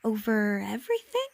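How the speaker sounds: mildly astonished, rising at the end; incredulous question. Acted. Little prince doubts the king's reach, incredulous. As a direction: repeat it as a wondering question.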